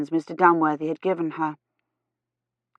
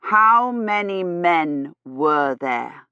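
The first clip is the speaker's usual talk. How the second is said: "How many men were there" drawn out very slowly, word by word; every word emphasized; much slower than usual. A narrator's reading. Gawyn questioning a lady he thinks deaf, slow and emphatic. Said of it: "emphasizing every word".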